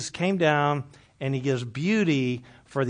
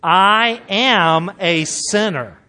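louder, slow, heartfelt confession; like you mean it; 4 semitones higher, 9 dB louder, much slower than usual. Shown as a demonstration. Pastor leading the congregation, loud and slow. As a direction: say it slowly and mean every word. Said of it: "say it like you mean it".

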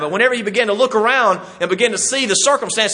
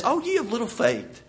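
higher, hushed lament; lamenting reproach; 6 semitones higher, 7 dB quieter than usual. Acted. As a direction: sigh it out high and soft, disappointed. Jesus's lament to disciples, acted.

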